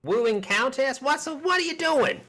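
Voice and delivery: high, startled NPC outburst; the merchant can't believe the plan; shocked shopkeeper. Acted.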